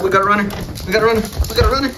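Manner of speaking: high excited alarm; alarmed shout. Real, not acted. Owner yelling as the dog runs off excitedly.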